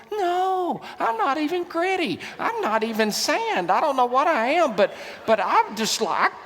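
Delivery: high-pitched, exasperated self-mockery; comic indignant denial. Preacher mocking himself over a failed book self-test, comic indignant denial. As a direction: blurt it out in mock dismay, pitched well up.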